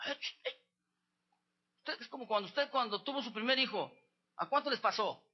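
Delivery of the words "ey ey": soft nudging call. Retold or imitated. Mother wakes the baby.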